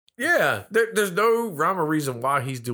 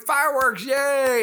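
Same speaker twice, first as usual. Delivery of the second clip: high, cheering mock-child voice; imitated children's high-pitched delight; excited little kids; 9 semitones higher, slower than usual. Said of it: "all the little kids are like".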